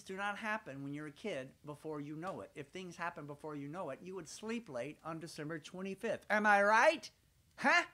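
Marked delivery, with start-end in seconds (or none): 6.3-7.8 loud appeal to the audience. Real, not acted. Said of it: "asks the audience".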